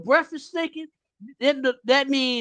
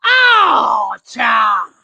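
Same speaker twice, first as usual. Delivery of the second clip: loud gushing greeting; 8 dB louder, much slower than usual. Retold or imitated. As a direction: imitate the coworker gushing, then shout the last words. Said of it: "emphasize certain words early in the morning".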